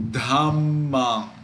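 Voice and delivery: dhammam with a long held double m in the middle; double consonant. Shown as a demonstration. Teacher giving another example, middle m held long in dhammam. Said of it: "We read it as dhammam".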